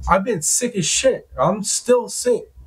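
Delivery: exasperated protest, pitched high; retold exasperation. Protesting being yelled at while sick, exasperated. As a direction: retell it as an aggrieved protest, stretching the last words.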